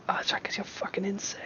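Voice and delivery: unvoiced whisper under breath; whispered aside. Real, not acted. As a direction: mutter it as a private aside.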